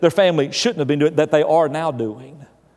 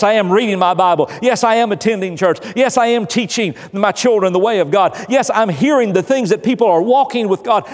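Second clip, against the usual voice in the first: preaching; 6 semitones higher than usual. Acted. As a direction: preach it up, rising with each yes. Preaching build-up.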